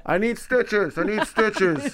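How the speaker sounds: put-on high, slow, pleading kid voice; kid through megaphone. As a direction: whimper it like a hurt kid on a megaphone. Voicing injured kid on megaphone.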